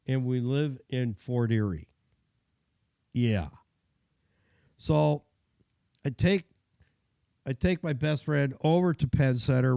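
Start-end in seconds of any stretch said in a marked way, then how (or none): none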